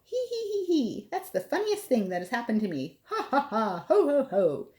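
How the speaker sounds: high hee-hee into ho-ho laughter; giggling elephant. Acted. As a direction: giggle then guffaw. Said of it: "He began to giggle".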